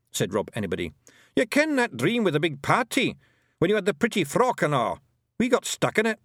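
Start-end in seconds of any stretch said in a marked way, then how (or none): 1.4-6.2 high, cocky Scots brogue; a proud wee Feegle warrior; broad Scots Feegle; Scottish pixie voice. A narrator's reading. Rob Anybody's Feegle voice.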